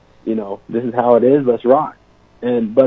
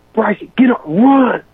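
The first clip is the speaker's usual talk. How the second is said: high, raised imitation of a coach; coach's order; 11 semitones higher, 5 dB louder, slower than usual. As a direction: order a player off like an angry coach. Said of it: "Mack tells me to run".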